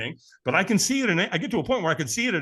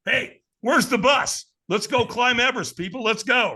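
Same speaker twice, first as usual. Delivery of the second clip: loud, high, exhilarated call to everyone; rally the crowd as if unstoppable; fired-up rallying; 8 semitones higher, 4 dB louder than usual. Retold or imitated. Acts out being fired up afterwards.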